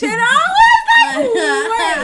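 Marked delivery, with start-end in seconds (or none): 1.2-2.0 high, loud laughing cry of disbelief; shocked yell. Retold or imitated. Replaying her reaction to her sister, loud laughing shock. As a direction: yelp it like you've just been accused.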